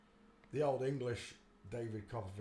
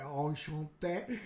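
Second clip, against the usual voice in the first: slow mock-French greeting; imitated French hand-kissing greeting, drawn out; French accent; 3 dB louder, much slower than usual. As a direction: stretch it out in a put-on French accent. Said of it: "like you do in the French".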